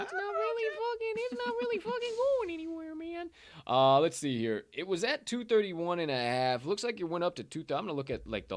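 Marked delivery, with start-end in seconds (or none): 0.0-3.3 mock falsetto. Continues mock voice on chart.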